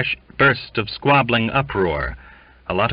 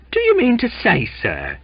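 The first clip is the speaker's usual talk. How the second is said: ominous question; 3 dB louder than usual. A narrator's reading. Elderly seaman challenging the captain, ominous. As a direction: confront a superior with menace. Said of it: "he asked ominously".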